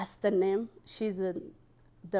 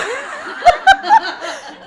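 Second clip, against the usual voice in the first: high laugh; 12 dB louder than usual. Real, not acted.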